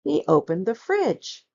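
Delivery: plain reading voice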